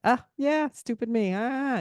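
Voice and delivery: high, airy self-mockery; self-mocking shrug. Mock shrug at herself in front of the dry guy. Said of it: "I kinda just went".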